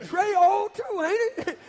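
high, loud laugh through the words; cracking up mid-question, slow. Real, not acted. Laughing at the sister's answer.

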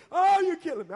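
high, loud mock wail of a whipped kid; mock child wailing. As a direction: ham up the kid's complaint. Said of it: "you think they killing you when you whip".

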